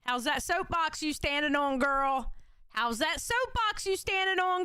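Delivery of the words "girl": ordinary talk, nothing marked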